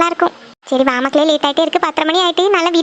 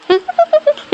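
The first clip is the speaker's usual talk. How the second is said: high-pitched giggle; light teasing laugh; 10 semitones higher than usual. Acted. Teasing a young wife about going alone, high giggle.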